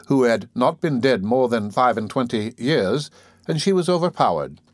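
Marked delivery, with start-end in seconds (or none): none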